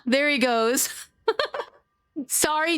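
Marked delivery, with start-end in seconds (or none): 1.3-2.8 sorry spilling out through real laughter; laughing sorry. Real, not acted. Streamer to chat apologizing mid-laugh, laughing.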